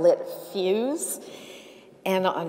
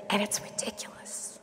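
a hushed dismissive aside; whispered scorn; 11 dB quieter than usual. Retold or imitated. Thinking at the yelling driver, a scornful whisper. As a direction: whisper it, mocking the bully. Scornful inner thought to cabbie.

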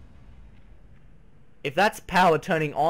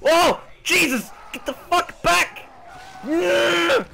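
panicked yelling; 9 semitones higher, slower than usual. Real, not acted. Gamer panicking as a monster jumps out. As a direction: scream in real fright.

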